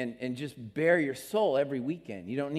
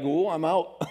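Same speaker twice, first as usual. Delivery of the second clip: laughing mock newcomer; 2 semitones higher, 5 dB louder than usual.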